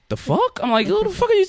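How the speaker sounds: high-pitched retold disbelief, squeaking up on "are". Retelling how he answered the girl, high stunned disbelief. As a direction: replay his own stunned reaction in a high voice.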